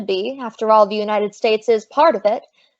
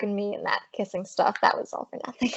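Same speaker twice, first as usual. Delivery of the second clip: whispered aside; 3 semitones lower, 11 dB quieter than usual. Acted. Maisie confides an aside to Gloria.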